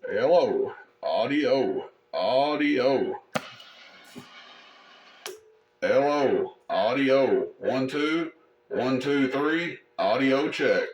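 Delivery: drawn-out one two and audio calls; long stretched test calls; radio mic check. Shown as a demonstration. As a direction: count off slowly like testing a CB mic. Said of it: "it does transmit".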